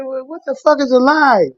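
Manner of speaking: high-pitched clueless question; mock bewilderment. Replying to Garfield's push to go live, mock bewildered. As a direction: squeal it, comically baffled.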